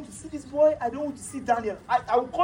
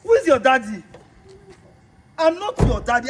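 angry rejection; 8 dB louder than usual. Acted. Husband rejects boy.

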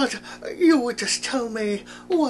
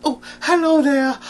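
Winnie the Pooh voice; 3 semitones higher, 6 dB louder than usual. Acted.

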